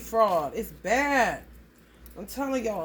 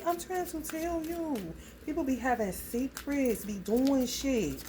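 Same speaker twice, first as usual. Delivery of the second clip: ordinary talk, nothing marked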